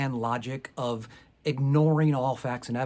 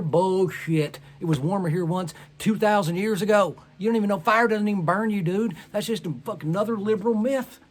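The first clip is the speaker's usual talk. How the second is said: loud, higher scoffing rant; ranting denier; 6 semitones higher, 6 dB louder than usual. Retold or imitated. Denier refusing to leave a burning room, loud mocking rant. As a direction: blast it as a stubborn denier.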